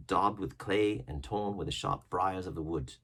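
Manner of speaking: plain reading voice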